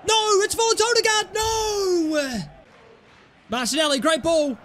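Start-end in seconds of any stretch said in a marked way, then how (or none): 0.1-2.4 frustrated shout. Real, not acted. Frustrated at pass.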